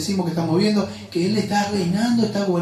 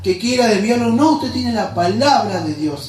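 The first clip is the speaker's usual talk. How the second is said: raised, higher-pitched preacher's exhortation; fervent preaching; 4 semitones higher than usual. Acted. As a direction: urge the congregation on with rising fervour. Exhorts congregation at full force. (predicación ferviente, con voz alzada)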